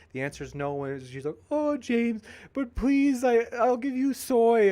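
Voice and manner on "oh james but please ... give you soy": high, whiny put-on pleading voice; mock pleading woman. Host mocking a fired moderator begging him, high whiny plea. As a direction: ham up a begging girl's voice.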